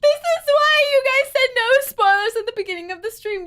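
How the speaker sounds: fast, high and loud; accusing outburst. Real, not acted. Player accuses chat over spoilers, accusing outburst.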